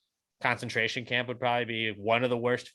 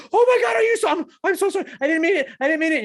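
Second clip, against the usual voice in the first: high, loud hammed-up panic; squeaky over-the-top remorse; frantic apology; 6 dB louder, faster than usual. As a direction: plead forgiveness from a hurt dog. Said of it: "drops down".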